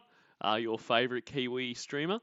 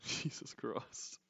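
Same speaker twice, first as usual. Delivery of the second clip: whispered jesus christ; 14 dB quieter, slower than usual. Real, not acted. Exasperated at flirting.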